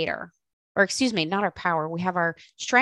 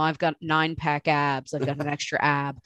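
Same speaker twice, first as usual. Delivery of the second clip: hammed-up vain superhero, sinking lower as it goes; Lego Batman, over the top; 5 semitones lower than usual. Superhero bragging about his abs, over-the-top vanity. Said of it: "Lego Batman, who is over the top".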